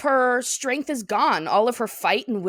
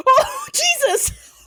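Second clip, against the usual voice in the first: high, squealing laugh through the words; shocked laughter; 6 dB louder, much slower than usual. Real, not acted. Co-host reacting to dark remark, shocked laughter. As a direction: burst out laughing, scandalized and high-pitched.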